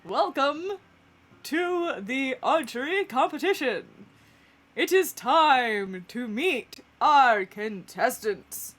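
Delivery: grand contest-host delivery; showy announcer. Acted. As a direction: proclaim it to the crowd, stretching words. Groundhog announcer opens the competition.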